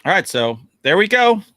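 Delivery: upbeat podcast sign-off; announcer voice. Acted. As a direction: announce the outro like a show host.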